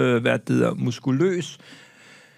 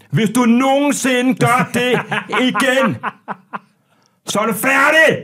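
loud, high, drawn-out threat, phrase by phrase; hammed-up roar, punching out each chunk slowly; mock furious shouting; 9 semitones higher, 5 dB louder, slower than usual. Biker threatening the man who parked, hammed-up furious shout. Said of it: "Står og råber mig ind i ansigtet".

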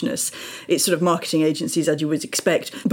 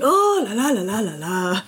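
mock commentator shout; 4 semitones higher than usual. Commentator after striker misses an open goal, mock shouting. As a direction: wail it like a commentator, held back in volume. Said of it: "hear the commentators shouting".

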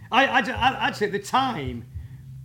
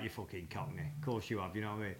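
mock muttered jibe; 9 semitones lower, 14 dB quieter than usual. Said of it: "we was all like".

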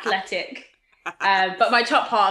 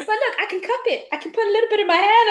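Gareth voiced; 11 semitones higher, 5 dB louder, faster than usual. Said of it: "Gareth's like".

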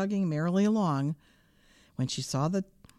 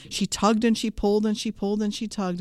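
plain reading voice

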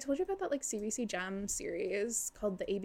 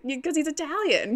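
loud, high, laughing retort; hammed-up accusation; mock insistence; 5 semitones higher, 8 dB louder than usual. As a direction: insist as if it were obvious.

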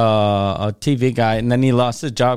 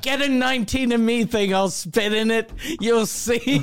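mock Italian; 7 semitones higher than usual. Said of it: "I'm gonna pretend like I'm from Italy".

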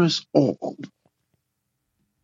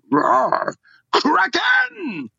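high shouted Kraken cry; monster roar; much slower than usual. Acted. Caller ending a message with a roared Kraken cry.

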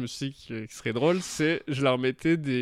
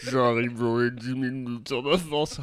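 very slow, stretched words; half-speed playback; 3 semitones lower, much slower than usual. Shown as a demonstration. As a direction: drag it out like slowed audio. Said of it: "En zéro virgule cinq".

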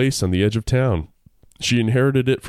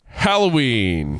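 slow, stretched announcement; drawn out; 3 semitones higher, much slower than usual. Real, not acted. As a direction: draw the word out.

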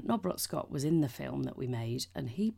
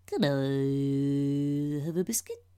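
very slow stretched, louder question; weird drawn-out delivery; 5 dB louder, much slower than usual. Retold or imitated. The friend ruining the take again, slow and strange. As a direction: drag it out oddly. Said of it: "At the end".

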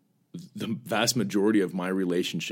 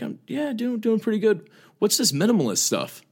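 much higher voice for his mom, curious; mimicking mom; 13 semitones higher than usual. Son imitating his mom on the phone, high mock voice. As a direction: pitch way up to play Mom asking questions.

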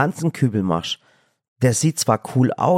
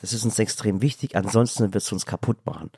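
ordinary talk, nothing marked

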